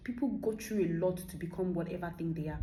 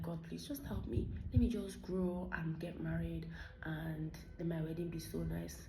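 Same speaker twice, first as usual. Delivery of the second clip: hushed retold prayer; 2 semitones lower, 8 dB quieter than usual. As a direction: quietly re-enact a private wish in prayer. Retelling her prayer at a wedding.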